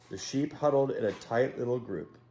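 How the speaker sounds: plain reading voice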